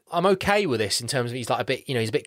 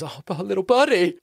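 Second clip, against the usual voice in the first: lightly voiced cheery wrestler; little buddy voice; 7 semitones higher than usual. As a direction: toss off a playful high line. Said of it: "and he goes".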